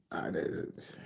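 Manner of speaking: breathy whisper, barely voiced; whispered denial. Real, not acted. Streamer whispers the denial back to teasing chat. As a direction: drop to a sly whisper.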